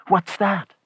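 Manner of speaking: high, startled question; surprised. A narrator's reading. Old woman hears the knock, startled surprise. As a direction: ask it in sudden high-pitched surprise. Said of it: "the old woman said in surprise".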